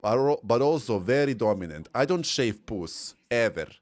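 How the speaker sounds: heavy mock Polish lady accent. Streamer as a dominant Polish lady, Polish accent. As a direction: play a proud Polish lady, heavily accented. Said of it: "I will say it in a Polish accent".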